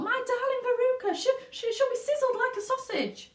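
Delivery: high, fast, stammering mother; a mother stammering in horror; panicked mother. A narrator's reading.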